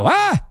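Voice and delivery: high-pitched mimicked exclamation; imitated "huh?" yelp. Host imitating newsroom colleague's reply to his whisper, high yelp. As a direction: squawk it high, mimicking someone who can't hear. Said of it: "I'll kinda whisper to him, and I get a".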